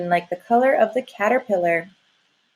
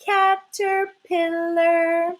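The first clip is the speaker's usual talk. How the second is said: caterpillar stretched out slowly and higher; slow, drawn out; 6 semitones higher, much slower than usual. Shown as a demonstration. Teacher reveals the spelled word, slow and stretched. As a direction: draw the word out for the children. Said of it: "That spells".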